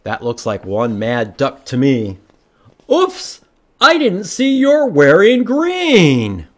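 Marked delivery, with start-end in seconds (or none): 2.9-6.5 high-pitched, drawn-out oops and excuse; sheepish apology. Acted. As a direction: excuse yourself to a mad duck. Wilford apologises to mad duck.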